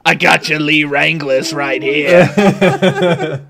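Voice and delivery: big, loud, drawn-out mascot voice; Kool-Aid Man. Acted. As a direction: burst in as a booming cartoon mascot. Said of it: "literally recreating the Kool-Aid Man".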